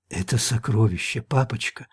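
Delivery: soft coaxing whisper to daddy; whispered child voice. Acted. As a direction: hush it like a little girl. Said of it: "прошептал голос".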